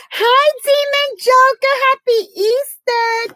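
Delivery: squeaky, slow and high; high-pitched greeting. Real, not acted. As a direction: greet them in a tiny high voice.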